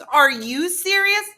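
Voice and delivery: slow, high, loud comeback; exasperated. Retold or imitated. Partner firing her question back, exasperated. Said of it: "his exasperation".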